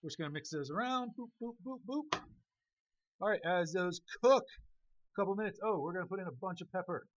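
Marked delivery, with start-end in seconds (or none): 1.2-2.2 playful boops. Acted. Playful noise while stirring pan.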